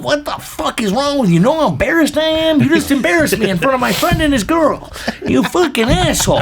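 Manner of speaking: high-pitched mock rant at a drunk friend; retold angry yelling. Buddy yelling at his friend the whole way home, in a high mock yell. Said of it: "fucking yelling at him the whole fucking way".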